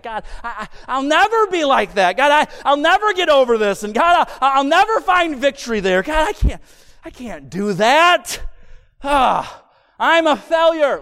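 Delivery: acted despair. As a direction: lament your failure with rising mock anguish. Acted despair building over failure.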